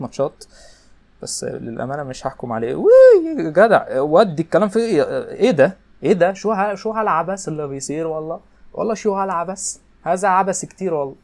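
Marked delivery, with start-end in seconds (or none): 2.7-3.5 a sudden very high, loud squeal; high squeal of shock. Real, not acted. Gamer shocked by a play, high loud squeal. 3.5-6.3 high, loud disbelieving exclamations; outraged outcry. Real, not acted. Gamer protesting a play, high loud outcry. 6.3-11.2 high, put-on Levantine Arabic accent; mock Levantine accent. Gamer mocking the chaos on screen in a Levantine accent.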